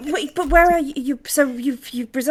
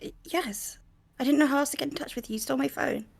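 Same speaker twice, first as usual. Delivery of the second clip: tipsy, quiet and rushed; a quiet, rushed, tipsy phone call; drunk on the phone; 3 semitones higher, 9 dB quieter than usual. Acted. Breeze voiced on phone.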